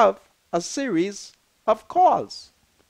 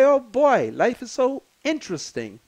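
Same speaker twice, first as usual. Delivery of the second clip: ordinary talk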